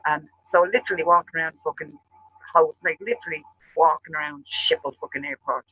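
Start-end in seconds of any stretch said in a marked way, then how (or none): none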